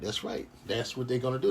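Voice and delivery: ordinary talk, nothing marked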